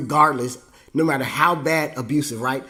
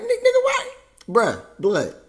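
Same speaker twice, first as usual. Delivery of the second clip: very high, loud comic squeal; squeaked disbelief; 4 dB louder than usual. Acted. Son asking dad why, in squeaky disbelief. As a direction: squeak it out through a laugh.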